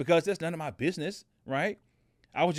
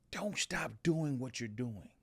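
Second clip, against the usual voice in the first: soft echoed plea; 3 semitones lower, 7 dB quieter, slower than usual. Retold or imitated. Echoing the homeless lady's plea.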